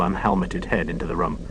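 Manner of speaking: plain reading voice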